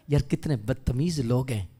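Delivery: ordinary delivery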